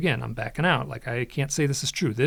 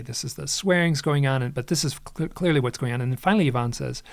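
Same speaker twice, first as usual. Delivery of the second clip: ordinary delivery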